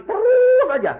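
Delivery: a ringing, endless "Terus"; long high drawn-out cry. Acted. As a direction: hold "Terus" high and loud like an endless call. Forever goes on and on.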